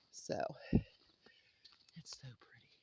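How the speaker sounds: soft breathy whisper. Real, not acted. Owner whispers at the pretty snowy view. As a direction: hush your voice to a whisper. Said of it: "whispered aside at the view".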